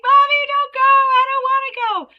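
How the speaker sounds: put-on high, loud whiny child pleading; crying child. Child at drop-off begging mom, high tearful whine. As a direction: wail it like a little kid begging mom to stay. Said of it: "all of a sudden they're like".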